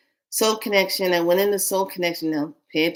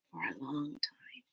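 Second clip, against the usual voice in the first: hushed; 5 semitones lower, 17 dB quieter than usual. Retold or imitated. Cacique speaking softly.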